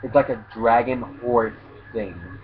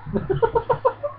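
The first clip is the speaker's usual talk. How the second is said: real squeal of laughter, very high; 3 dB quieter than usual. Real, not acted. Narrator laughing at the turnip joke, high squeal. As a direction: let out a high giggle.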